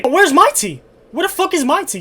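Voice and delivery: loud, high indignant demand. Real, not acted. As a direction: demand it, outraged. Mocking the principal's tea break.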